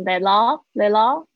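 "That all" said as "the-law"; loud, linked "tha-law"; linked flapped T. Shown as a demonstration. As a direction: turn the T of "That all" into an L-like sound. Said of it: "会浊化变成好像了的声音".

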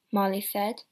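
usual speaking voice

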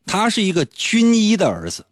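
ordinary talk

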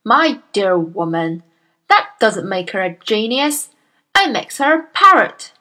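loud, booming bully of a headmistress; Miss Trunchbull. Acted. Bullying headmistress scolds Miss Honey, booming and loud. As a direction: bark at the timid teacher like a tyrant.

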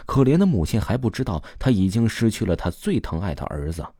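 ordinary delivery

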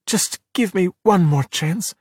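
slow, high plea; beg, drawing the words out; pleading. A narrator's reading. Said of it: "Luca pleaded".

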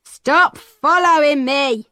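put-on slow, gruff old man brushing her off; irritated old peddler. A narrator's reading. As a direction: sound like a fat old man telling a girl off. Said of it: "he said".

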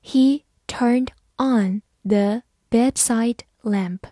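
slow, word-by-word model reading; teacher's slow read-along pace. Shown as a demonstration. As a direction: leave a gap after each word. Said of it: "慢读一遍".

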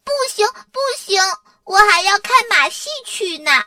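put-on high, fast, insistent child's objection; loud protest. A narrator's reading. Dora insists on going to the circus, protesting loudly. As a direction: protest shrilly and quickly, refusing to give in. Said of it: "多拉大声地反对".

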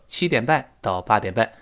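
ordinary talk, nothing marked

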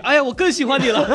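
put-on very high, giggly girlish squeal; mock swooning girl, laughing through it; gushing girl voice. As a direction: ham up a smitten schoolgirl. Shizuka gushing, voiced in retelling.

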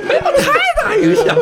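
very high voice squeezed by laughter; real laughing fit through the words; helpless laughing. Real, not acted. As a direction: barely get the words out for laughing. Repeats joke while cracking up.

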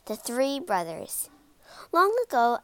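ordinary delivery, nothing marked

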